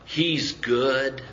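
ordinary talk

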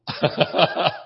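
breathy, voiceless chuckle; laugh. Real, not acted. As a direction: laugh at your own joke. Laughs at own salad joke.

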